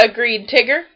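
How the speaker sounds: usual speaking voice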